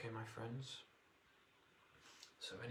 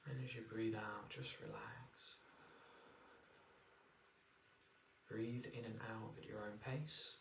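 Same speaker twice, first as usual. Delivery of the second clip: guided meditation. Acted. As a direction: ease them into relaxation.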